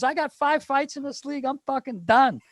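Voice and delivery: high-pitched mock outrage; hammed-up whine of a teammate who quits; Phil Kessel. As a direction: protest in a squeaky put-on voice. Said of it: "He goes".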